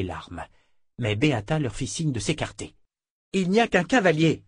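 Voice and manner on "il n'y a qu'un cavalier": firm commanding sergeant, higher; calm the troops with authority; a sergeant reassuring her soldiers with authority; authoritative. A narrator's reading. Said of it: "d'une voix pleine d'autorité".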